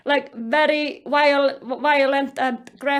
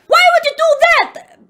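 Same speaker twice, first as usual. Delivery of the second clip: high, loud indignant outburst; acted yell; 11 semitones higher, 8 dB louder than usual. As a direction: yell it as the bitten man's protest. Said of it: "Jerry is yelling this".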